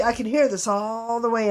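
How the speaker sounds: ordinary talk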